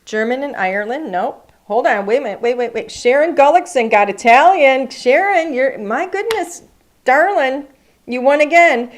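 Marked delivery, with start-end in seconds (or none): 2.9-8.9 excited winner announcement. Real, not acted.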